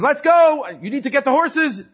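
high, loud mock command; bossy order. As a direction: order the real owner to fetch horses. Wagon driver bossing.

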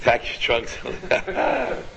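slow breathy whisper, savouring the joke; amused whispered repeat. Real, not acted. Rabbi echoing a listener's pun to the group, amused whisper.

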